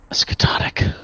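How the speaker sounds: gasped fearful whisper; gasp, then whisper the name; shocked whisper. Acted.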